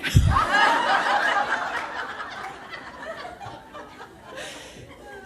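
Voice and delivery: sustained high-pitched laughter; real, long laughter. Real, not acted. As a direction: laugh at the punch line. Long laugh after quote.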